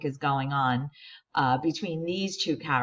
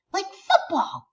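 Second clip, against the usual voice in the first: put-on eager kid; 4 dB louder than usual. Eric blurts out.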